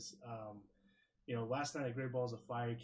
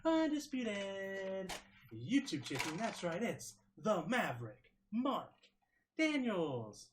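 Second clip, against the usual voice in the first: booming ringmaster announcement; 6 semitones higher, 5 dB louder, slower than usual. Acted. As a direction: announce yourself like a circus ringmaster. Ringmaster style self-introduction.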